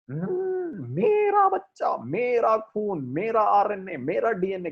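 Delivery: high, drawn-out mocking whine on मेरा; sing-song mock of a possessive parent.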